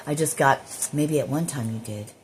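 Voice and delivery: ordinary talk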